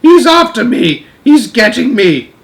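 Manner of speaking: high, frantic giant wailing; panicked screaming. Acted. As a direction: cry out as if being chased. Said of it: "screamed the flesh lump eater, thrashing about madly".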